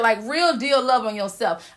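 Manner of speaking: ordinary talk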